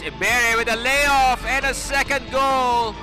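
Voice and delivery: loud rising goal shout; excited goal call. Real, not acted. Goal called in highlight.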